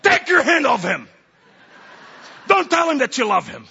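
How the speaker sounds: high, loud, forceful command; outraged shout. Acted. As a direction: shout it as an urgent order. Acted outraged orders in testimony.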